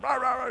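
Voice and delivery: mock barking, rah rah rah; imitated dog bark. Dog Spot barking at deer.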